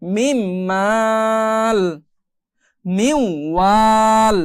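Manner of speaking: মিউ ওয়াল very slow and drawn; slow Quranic reading. Shown as a demonstration. Teacher recites the merged word slowly. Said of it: "তো আমি এখানেও মিলিয়ে পড়বো".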